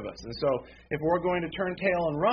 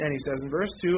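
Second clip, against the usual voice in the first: ordinary talk, nothing marked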